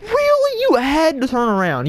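squeaky, very high really; outraged at the bad luck; killed from behind, disbelieving; exasperated disbelief. Real, not acted. Exasperated at being spotted.

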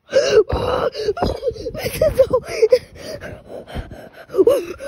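high, loud gasping whimper; terrified gasping cry. Real, not acted. Player touched by the doll, terrified gasp.

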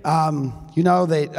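假澳洲口音; Australian accent. Pretend. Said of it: "I'm not".

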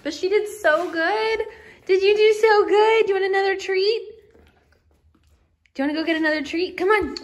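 high doting praise voice; dog praise. Real, not acted. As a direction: gush over the dog.

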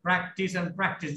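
slow, stretched practice and practice; drawn-out stress on practice. Shown as a demonstration. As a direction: lean hard on each practice. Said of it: "always remember".

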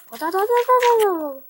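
high, drawn-out playful "ada-da" noise; silly high mining sound; high babbling noise. Acted. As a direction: squeal out a cartoon rattle.

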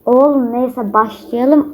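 normal talking voice

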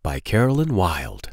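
singsong picture-book read-aloud; reading to children. Acted. Storyteller reading a lamb book to kids, singsong. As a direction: lilt the question refrain for little listeners.

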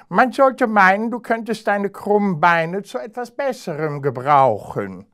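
high, loud sneering hare voice; scornful hare. Acted. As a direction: taunt his crooked legs from above. Haughty hare taunts hedgehog.

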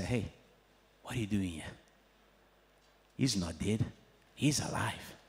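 low, soft, calm voice of the angels; quiet angel voice. Retold or imitated. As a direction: tell them gently, low and hushed. Angels speak at the empty tomb.

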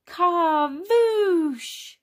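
high, loud drawn-out whoosh; high, playful little-child voice; toddler with toy car. Acted.

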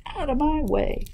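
high, bossy goose; goose's cry. Acted. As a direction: exclaim high as a goose pushing through. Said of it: "cried the goose".

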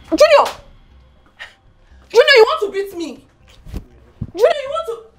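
high, shocked exclamation; alarmed outcry. Acted. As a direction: cry out as your child threatens you. Son moves to hit mother.

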